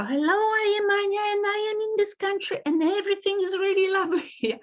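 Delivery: high, fast, bubbly greeting; overly cheerful. Shown as a demonstration. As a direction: gush like an excited host. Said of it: "So that's okay, but it's a little bit out of context".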